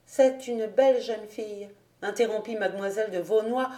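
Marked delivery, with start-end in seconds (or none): none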